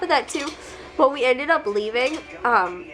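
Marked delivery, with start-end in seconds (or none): none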